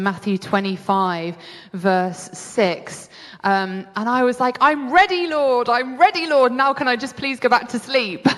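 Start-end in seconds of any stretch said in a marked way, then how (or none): 4.6-8.3 higher, hurried-sounding comic plea; mock pleading. Sleepy believer begging the Lord for rest, mock pleading. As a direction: beg to be let off, half in jest.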